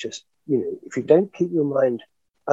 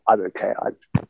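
imitating broken voice. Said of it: "Donald Duck with an attack of cramps".

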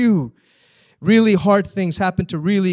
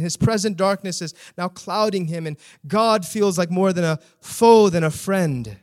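ordinary delivery

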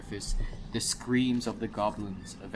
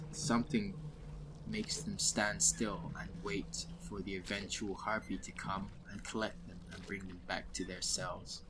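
quiet storytelling; 4 semitones lower, 8 dB quieter than usual. Acted. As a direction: murmur the lore darkly. Dwarf tells ominous lore.

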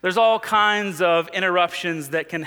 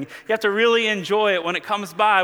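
ordinary talk, nothing marked

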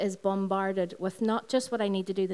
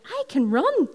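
high, loud triumphant burst; joyful realization; 9 semitones higher, 6 dB louder, slower than usual. Retold or imitated. She relives finally running, high and loud with joy. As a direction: let the joy break out. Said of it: "And I started to cry".

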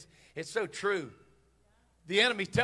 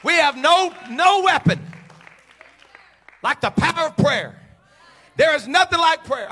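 loud, high emphatic proclamation; full-force preaching; 9 semitones higher, 14 dB louder than usual. Real, not acted. Preacher proclaiming prayer's power, loud emphatic shout. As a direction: punch it out to the whole church.

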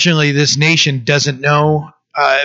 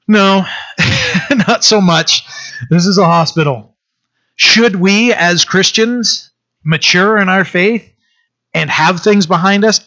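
rising sermon fervor; 5 semitones higher than usual. Acted. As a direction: build to a fervent preacher's call. Preacher rising to full force.

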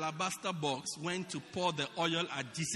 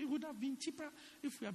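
high, fast, softer mock grumble; mocking whine; 8 semitones higher, 6 dB quieter, faster than usual. Preacher mimics grumbling members, high fast mock whine. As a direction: toss off the grumble high and quick. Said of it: "So they say".

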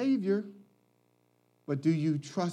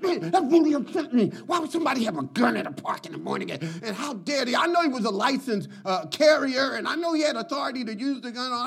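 higher, fast aggrieved rant; upset barber; 7 semitones higher, faster than usual. Retold or imitated. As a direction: mimic an offended friend venting. Barber complains about the gun, retold.

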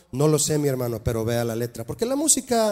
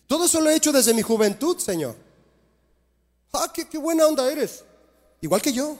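smug proud man; 9 semitones higher than usual. Acted. Acting the proud young man.

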